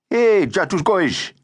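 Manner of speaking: high, drawn-out taunting call; brash teasing boy. A narrator's reading. Ramazan hails the new boy with a nickname, brash and teasing.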